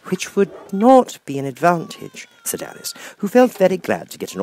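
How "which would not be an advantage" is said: put-on slightly higher, louder, slow know-it-all girl; lightly voiced little girl. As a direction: show off a little, as a child. Alice voice.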